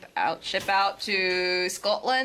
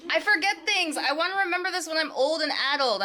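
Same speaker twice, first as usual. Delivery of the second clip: high, loud hammed-up wail; mock melodramatic protest; 10 semitones higher, 4 dB louder than usual. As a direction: play the misunderstood one, wailing in a rush. Mock protest after being told shut up.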